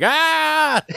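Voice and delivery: high, loud "gotcha" yell; mock scare scream. Redbeard scares one of the guys, high mock scream. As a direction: burst out with a high, playful scream. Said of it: "pulls his eye thing up, and he's like".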